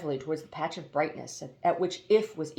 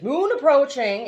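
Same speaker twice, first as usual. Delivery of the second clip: louder, higher robot-bird call; machine announcement; 8 semitones higher, 9 dB louder than usual. A narrator's reading. Hoopoe announcing the landing to passengers, robotic. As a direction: announce like an autopilot.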